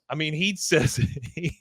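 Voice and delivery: usual speaking voice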